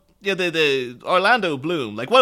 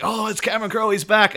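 high-pitched eager fan cheer; retold excitement; 9 semitones higher than usual. Fan recalling news of Cameron Crowe's film, excited. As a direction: relive the giddy anticipation, pitched up.

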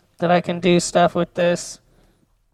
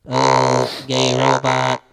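robot voice; 6 semitones lower, slower than usual. Shown as a demonstration. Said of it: "can I do a robot?".

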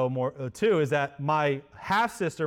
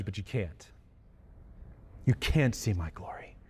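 soft refusal; 7 semitones lower, 6 dB quieter than usual. Acted. God gently refusing Moses.

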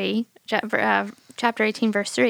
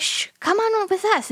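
hushing; 11 semitones higher than usual. Retold or imitated. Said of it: "And they're like".

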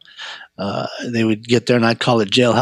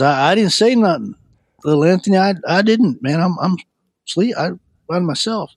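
higher-pitched, stammering protest; defensive denial; 6 semitones higher than usual. Retold or imitated. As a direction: act out a halting, innocent denial. Acted denial from an accused cellmate.

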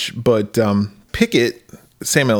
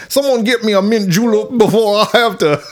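high-pitched, hammed-up Southern accent; 9 semitones higher than usual. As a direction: ham up a theatrical Southern accent. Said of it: "where they talk like this".

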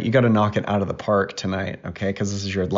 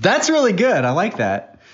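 excited praise; 11 semitones higher, 4 dB louder than usual. Real, not acted. Dad praising performance.

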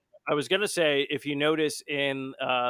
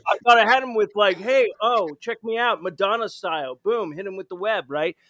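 cocky bragging; 5 semitones higher, 6 dB louder than usual. Retold or imitated. Retelling own cocky thoughts in game.

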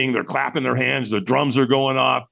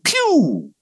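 imitated zoom, pitched way up; high whooshing pew; 13 semitones higher than usual. Storyteller mimics a guy disappearing into the night, high pew. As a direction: make a high pew of something shooting away.

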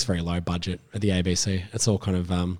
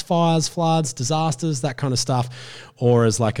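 ordinary talk, nothing marked

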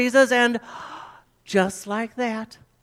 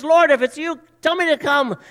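Peter calling out; 7 semitones higher, 5 dB louder than usual. Retold or imitated. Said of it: "he says".

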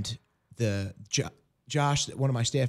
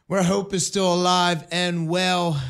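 radio host welcome; 10 semitones higher, 6 dB louder than usual. Acted. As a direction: open the show like a warm radio host. Host opens the radio show.

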